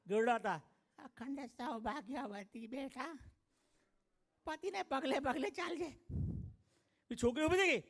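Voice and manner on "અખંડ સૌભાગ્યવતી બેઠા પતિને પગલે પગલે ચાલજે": put-on slow, soft old granny blessing; old grandmother. એક બા આયા, ગરડા હતા.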